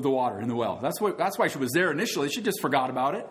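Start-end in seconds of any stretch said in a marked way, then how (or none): none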